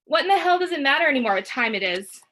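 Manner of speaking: high loud outburst, close to crying; loud, near tears. Acted. Mother shouting at dad, near tears. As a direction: shout it, voice about to break. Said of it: "Mom's voice is loud and she sounds like she's about to cry".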